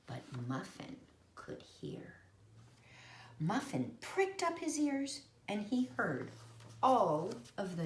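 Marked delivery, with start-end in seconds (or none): 0.1-2.1 hushed whisper. A narrator's reading. Narrator whispers to children that Muffin hears. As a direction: breathe it as a secret. Story narration, dramatic hush.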